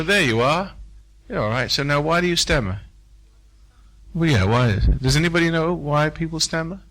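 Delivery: normal talking voice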